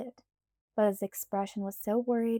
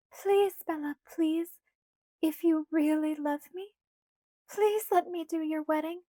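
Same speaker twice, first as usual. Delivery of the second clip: put-on light, higher girlish pleading voice; Alice's voice; 7 semitones higher than usual. A narrator's reading. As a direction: voice Alice higher, coaxing Bella. Alice begging.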